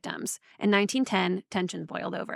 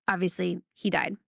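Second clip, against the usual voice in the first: usual speaking voice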